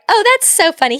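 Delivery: high, breezy chirp; high fake-cheery vet. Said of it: "they're like".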